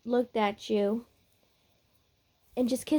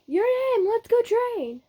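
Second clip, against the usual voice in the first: much higher, insistent character voice; brash training partner; 12 semitones higher than usual. Acted. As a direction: pester them in a high voice. Brash rival repeats his call.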